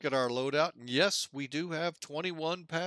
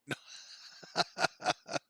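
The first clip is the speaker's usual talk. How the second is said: breathy chuckle. Real, not acted. Laughs at his own rain joke.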